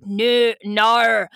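hammed-up accented old man's refusal; grandfather. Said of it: "Yami's grandfather's like".

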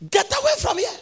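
very high-pitched cry of a mother; acted angry order. Mother sending daughter away from the house, acted high angry cry. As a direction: throw them out with a high, sharp order.

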